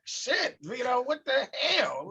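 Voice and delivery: high, startled curse; shocked outburst. Retold or imitated. Friend shocked at seeing Vito, startled curse. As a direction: blurt it out in disbelief. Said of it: "I was like".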